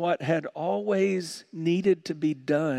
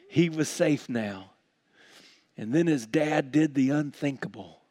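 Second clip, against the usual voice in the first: normal talking voice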